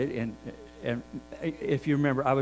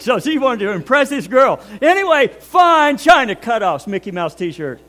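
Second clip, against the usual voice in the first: loud, excited storytelling; 7 semitones higher, 11 dB louder than usual. Real, not acted. Building comic story climax.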